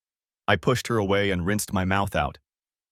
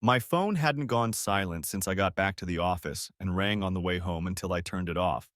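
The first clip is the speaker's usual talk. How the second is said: ordinary delivery, nothing marked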